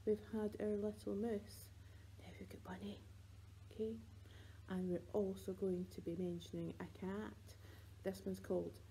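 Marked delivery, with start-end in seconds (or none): 2.2-3.0 whisper. Real, not acted. Aside while settling baby.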